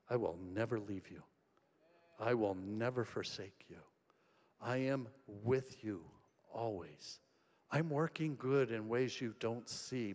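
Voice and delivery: soft, low reassuring voice of God; God's gentle promises. Pretend. Pastor voicing God's promise to the hurting, softly and low.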